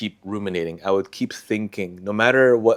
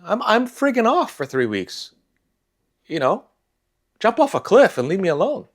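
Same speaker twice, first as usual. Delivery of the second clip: much higher, worked-up venting; exasperated outburst; 12 semitones higher than usual. Real, not acted. Venting about the pushy stakeholder, exasperated and much higher. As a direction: let the disbelief boil over.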